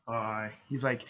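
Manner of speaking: ordinary talk, nothing marked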